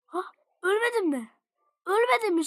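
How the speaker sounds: high, rising disbelief; surprised disbelief. Real, not acted. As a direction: exclaim it in astonishment. Surprised at surviving.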